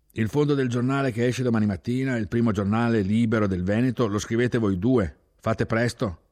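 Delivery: loud, urgent boss giving orders; commanding order. Acted. Chief orders two young men to write the editorial, loud and urgent. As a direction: bark it out as the chief in a hurry.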